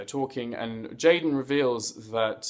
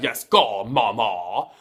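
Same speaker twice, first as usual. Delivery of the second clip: louder, cheering yes, go, mama; hype cheer; 5 dB louder, slower than usual. Pretend. Cheering on La Ganja, louder hyped yes go mama. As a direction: hype her up like a fan.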